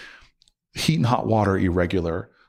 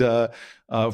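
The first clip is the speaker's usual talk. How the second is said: usual speaking voice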